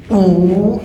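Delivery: loud, high ooh of effort; effort grunt. Shown as a demonstration. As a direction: push the sound out with the swing. Effort sound on release.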